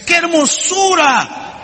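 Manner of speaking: high, slow, ringing cry of delight; delighted sculptor. Acted. (exclamación de asombro encantado, aguda y lenta)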